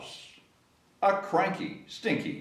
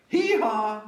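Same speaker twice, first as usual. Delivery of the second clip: high, loud braying hee-haw; donkey bray; 7 semitones higher, 4 dB louder than usual. Shown as a demonstration. Reading to children, loud high donkey hee-haw. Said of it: "One more time".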